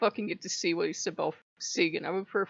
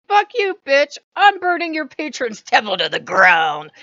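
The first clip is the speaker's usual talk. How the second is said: much higher, louder furious yell; furious shout; 5 semitones higher, 12 dB louder than usual. Retold or imitated. Retelling raging at a rival god's follower, shouting. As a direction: scream the threat in full rage. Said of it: "I'm like".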